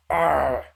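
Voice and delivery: "R" drawn out with a strong R sound; heavy R. Shown as a demonstration. Showing how much R Heavy has, "R" said long and hard. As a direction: growl out the R in "R". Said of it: "Heavy's voice is very R intensive".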